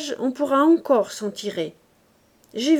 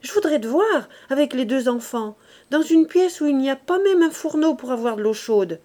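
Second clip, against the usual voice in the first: louder, higher indignant protest; growing heated; 4 semitones higher, 6 dB louder than usual. A narrator's reading. Wife protesting to her partner, louder and indignant. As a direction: push back hotly at last. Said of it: "dit-elle en s'animant".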